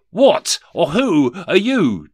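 demanding. Acted. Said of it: "demanded Rishi Scrooge".

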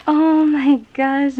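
high, loud, slow oh my gosh; drawn-out delighted gasp. Real, not acted. Finding the surprise gift inside, delighted and gasping. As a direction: stretch it out in real delight.

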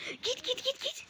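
high, soft urgent pleading. Real, not acted. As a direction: beg them to go away, high and hushed. Urging enemy to leave. (yüksek sesle, fısıltıya yakın yalvarış)

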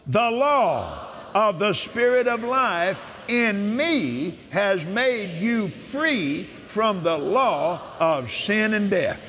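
solemn preacher's proclamation; preaching scripture. Acted. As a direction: declare it as the voice of God himself, slowly.

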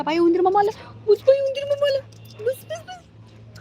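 very high squeaky pet-teasing voice; playful squeal. Pretend. Owner tells the dog uncle mouse has come, squealing. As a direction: climb into a falsetto squeak.

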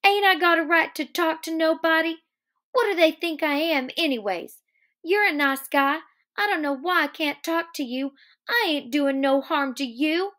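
high, indignant protest, loud at first; acted aggrieved outburst; angry. A narrator's reading. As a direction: pitch it way up and complain hotly. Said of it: "her face grew angry".

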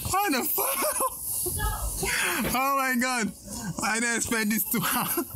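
high-pitched helpless laughter through the words; cracking up, can barely talk; laughing hard. Real, not acted. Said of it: "I'm laughing right now".

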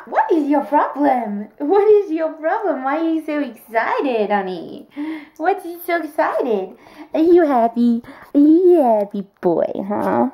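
playful dog talk. Real, not acted. Asking her excited dog what his problem is, playful pet talk. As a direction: tease the excited puppy.

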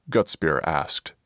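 ordinary delivery, nothing marked

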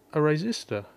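ordinary talk, nothing marked

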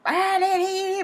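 high-pitched nonsense jabber mimicking locals; mock babbling. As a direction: babble high in mockery of how they talk. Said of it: "they're all like".

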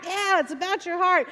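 high, lively shout-back; cheering congregation. Retold or imitated. Congregation answers the preacher, high cheering call. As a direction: imitate a crowd echoing its preacher. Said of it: "they'd be cheering".

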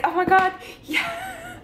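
high squealing "Oh my god, yeah" breaking into a laugh; overjoyed squeal. Retold or imitated. Vlogger reliving the happy ending, squealing with joy.

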